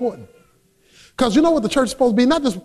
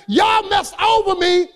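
higher, slow, accusing cry at the brothers; Joseph to his brothers; 7 semitones higher, slower than usual. Retold or imitated. Joseph confronting his brothers, a touch of anger. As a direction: call them out on the wrong done. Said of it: "looked at his brothers and said".

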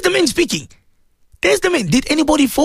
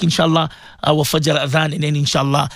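normal talking voice

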